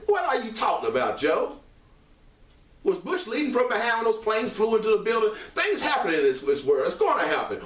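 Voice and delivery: real heated rant, high-pitched; excited. Real, not acted. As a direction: fire off indignant questions, pitch way up. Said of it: "I'm getting excited again".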